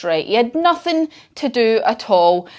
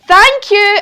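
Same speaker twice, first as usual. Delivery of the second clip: higher, louder grateful "Thank you"; Bear's thank you; 9 semitones higher, 6 dB louder than usual. Acted. Bear thanking the children for his sandwich, higher and grateful. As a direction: thank them sweetly as the bear. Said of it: "And Bear says".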